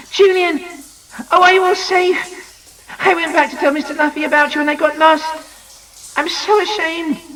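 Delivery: high, rushed relief; relieved and excited. A narrator's reading. Anne pouring out relief at finding the children. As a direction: pour it out in a relieved rush.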